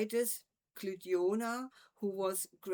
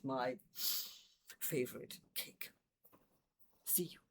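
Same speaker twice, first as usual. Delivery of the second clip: whisper; 5 semitones lower, 6 dB quieter than usual. Real, not acted. Signing off for a snack break.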